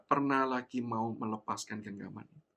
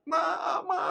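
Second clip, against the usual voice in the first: put-on crying child; 5 dB louder than usual. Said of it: "Mulai saya mulai menangis".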